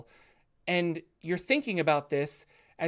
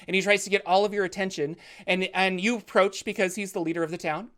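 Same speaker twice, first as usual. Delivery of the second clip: ordinary delivery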